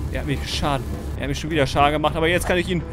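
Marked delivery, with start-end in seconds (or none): none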